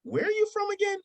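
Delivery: very high imitated woman's voice; questioning woman. Woman who stops and turns around, high mimicked question. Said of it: "and she was like".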